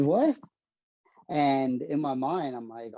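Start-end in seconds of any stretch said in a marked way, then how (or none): 0.0-0.4 a much higher, louder "What?"; mock the dad's disbelief, pitched up; dad's incredulous what. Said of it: "He said".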